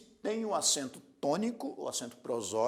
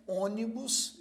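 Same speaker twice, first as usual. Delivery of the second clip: "ônibus" higher, stressed on Ô; stress on first syllable; 6 semitones higher, slower than usual. Shown as a demonstration. Teacher showing the antepenultimate stress, "ônibus" as Ô-ni-bus. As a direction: hit the Ô of "ônibus". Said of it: "a primeira sílaba desta palavra é a mais forte".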